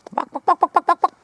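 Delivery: high-pitched mock clucking at the hens; squeaky imitation hen call; chicken clucking.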